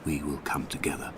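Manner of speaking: low and quiet, fading toward a near whisper; hushed, fading. Acted. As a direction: let the words sink away like ink in water. Narrator drifting into hushed reverie.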